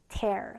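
"tear" said like "tare", with an open eh; verb pronunciation. Shown as a demonstration. Teacher shows students the verb, "tear" pronounced like "tare". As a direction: say "tear" to rhyme with "air". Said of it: "자, 발음 어떻게 해요?".

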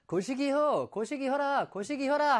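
high Jeolla dialect lilt. Shown as a demonstration. Teacher shows what Jeolla people say, Jeolla dialect. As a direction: repeat it in a put-on Jeolla accent. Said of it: "전라도에서는".

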